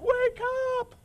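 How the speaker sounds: high panicked cries, wake up; panicked yelling. Retold or imitated. Preacher acting the disciples waking Jesus in the storm, panicked high yelling. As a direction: yell like the boat is sinking. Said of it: "they're all like".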